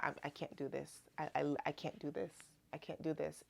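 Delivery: low, hushed self-talk; soft, sinking retold worry; fearful doubt. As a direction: quietly replay giving up under fear. Acting out her own fearful doubt.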